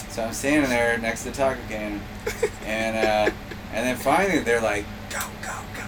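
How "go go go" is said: hushed, voiceless urging; imitated stage-side whisper; whispered cue. Retold stage cue from the wings.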